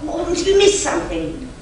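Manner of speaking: ordinary delivery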